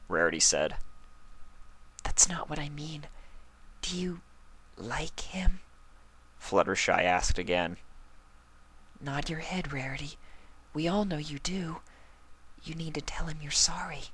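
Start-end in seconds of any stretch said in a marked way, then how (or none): 2.0-5.6 high, soft, breathy-whispered shy pony; whispering Fluttershy. Acted. 9.0-14.1 high, soft, breathy-whispered shy pony; whispering Fluttershy. Acted. Fluttershy coaxing softly.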